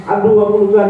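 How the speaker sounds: hammed-up ouch, I'm hurt; mock cry of pain. Shown as a demonstration. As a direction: act out a hurt child calling for help.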